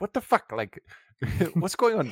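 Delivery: put-on high, baffled South African accent; mock bewilderment in a South African accent, laughing. Host mimics bewildered Vern from the film, South African accent. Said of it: "the South African accent and the New Zealand accent".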